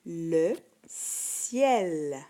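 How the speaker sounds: "Le ciel" with a slow, crisp S; teacher modelling a word; careful reading. Shown as a demonstration. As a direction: spell out "ciel" slowly for a first grader. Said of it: "Donc, ça va être un S".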